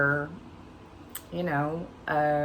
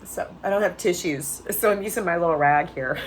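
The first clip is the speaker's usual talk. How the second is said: tearful; 3 semitones higher, 4 dB louder than usual. Real, not acted. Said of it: "it's hard to talk when you're crying like that".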